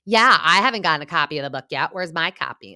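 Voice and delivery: brisk, pointed jab about the book; imitating Margaret. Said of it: "Margaret's like".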